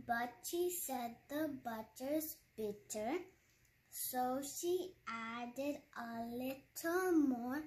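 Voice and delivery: slow, word by word; careful slow demo; slow teaching version. Shown as a demonstration. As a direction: spell it out slowly for learners. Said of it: "now I'm gonna teach you how to do".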